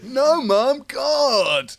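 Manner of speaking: put-on high, loud teenage groan; exasperated teen. Teenager groaning at his mum about the kebab, high and loud.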